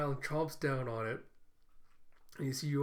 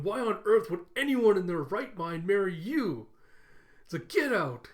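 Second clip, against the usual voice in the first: raised, louder mock outrage; mounting anger; 4 semitones higher, 6 dB louder than usual. Camilla blows up at Yor, acted angry shouting. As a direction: act out a furious rant. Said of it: "getting angrier and angrier".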